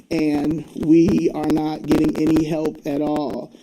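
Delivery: usual speaking voice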